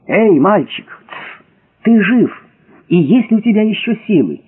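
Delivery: высокий тревожный оклик вниз; позови пропавшего, повысив тон; приглушённый оклик. A narrator's reading. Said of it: "поспешно понизив голос, крикнул в глубину". (осторожно окликая в глубину)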